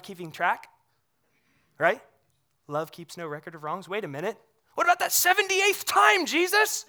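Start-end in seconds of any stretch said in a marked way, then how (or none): 4.7-6.8 high, questioning put-on voice; mock objector. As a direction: play the nitpicker counting wrongs.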